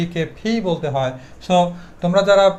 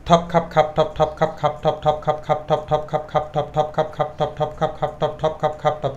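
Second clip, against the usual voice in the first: rapid, even, chanted repetition; steady machine-like drill, every stop aspirated; one-breath tongue twister; 3 semitones lower, 4 dB quieter than usual. Shown as a demonstration. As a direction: rattle it off on one breath without stopping. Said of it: "আমি একবার দেখিয়ে দিচ্ছি".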